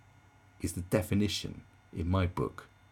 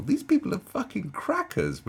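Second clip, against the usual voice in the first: laughing disbelief; 13 semitones higher, 4 dB louder than usual. Real, not acted. Laughing at absurd people.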